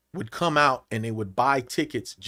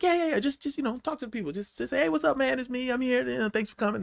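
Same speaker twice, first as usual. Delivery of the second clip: coaxing promoter; 9 semitones higher, 8 dB quieter than usual. Retold or imitated. Retells the promoter coaxing him.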